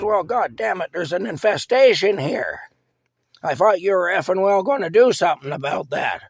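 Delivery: loud, high-pitched furious rant; angry cursing. Retold or imitated. Wife cursing at husband over mice.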